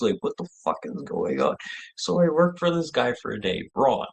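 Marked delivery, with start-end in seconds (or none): none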